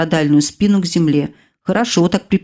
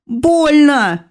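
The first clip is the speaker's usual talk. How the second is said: a sharp high cry of pain; pained howl; 8 semitones higher than usual. A narrator's reading. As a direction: wail it out, hurt. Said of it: "взвыла я". (резкий вскрик от боли)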